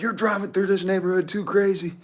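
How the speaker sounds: high, loud mock scolding; imitated yelling. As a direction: mimic an irate neighbor yelling. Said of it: "he's, like, yelling at me".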